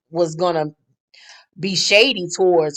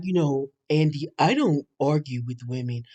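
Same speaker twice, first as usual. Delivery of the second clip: Damon imitation; 3 semitones lower, 8 dB quieter than usual. Mocking Damon explaining himself to Andy, low and soft. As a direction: murmur it low and meek, barely there. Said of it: "And he was like".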